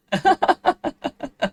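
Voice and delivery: squeaky high laugh; high-pitched laugh. Real, not acted. After a failed high note, a squeaky high laugh. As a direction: laugh high and loud at yourself.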